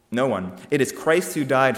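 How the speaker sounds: normal talking voice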